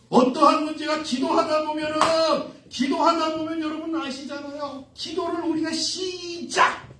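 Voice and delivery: high, insistent sermon chant; forceful preaching. Acted. Preacher voicing believers' prayer vows, high insistent delivery.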